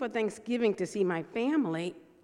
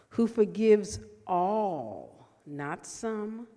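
slow, emphatic psalm reading with long pauses; scripture reading; 2 semitones lower, 5 dB louder, much slower than usual. Acted. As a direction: read the psalm slowly, leaning on each phrase.

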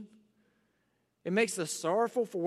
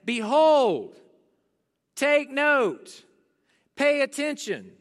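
booming Behold, then higher and louder commands; loud voice from the throne; loud proclamation; 4 semitones higher, 5 dB louder, much slower than usual. Acted. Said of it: "in a loud voice".

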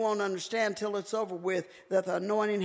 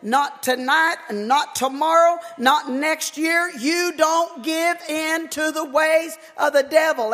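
loud, high, building preacher's cry; preaching at full force; 5 semitones higher, 6 dB louder than usual. Acted. Preacher warning the church about the devil, in a loud, building full-force sermon cry. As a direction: hammer it home from the pulpit.

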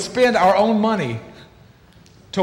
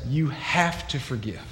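low, soft and slow divine command; a hushed, solemn you have to forgive; voice of God; 4 semitones lower, 7 dB quieter, slower than usual. Retold or imitated. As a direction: speak gently for God, firm but hushed. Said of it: "God says".

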